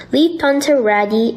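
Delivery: plain reading voice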